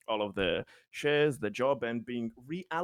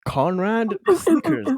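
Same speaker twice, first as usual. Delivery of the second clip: high, louder, breaking into laughter; snickering amusement; 12 semitones higher, 5 dB louder, slower than usual. Real, not acted. Reacting to the comedian's joke, snickering amusement. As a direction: narrate it through a rising snicker.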